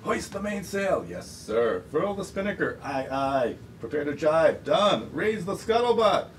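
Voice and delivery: high, drawn-out orders with brisker replies; calling sailing orders. Acted. Reader voices sailors trading orders, commanding. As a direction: call out commands across a boat.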